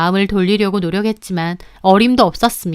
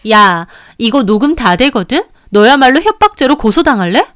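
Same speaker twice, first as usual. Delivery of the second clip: furious retort; 3 semitones higher, 5 dB louder than usual. Acted. Mistress tears into the husband, furious. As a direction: snap back, building to a yell. Quarrel in recorded phone call.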